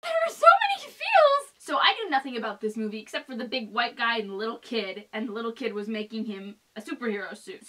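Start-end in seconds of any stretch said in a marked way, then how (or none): 0.0-1.5 high squealing gush; excited squeal. Real, not acted. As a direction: squeal with delight. Gushing squeal.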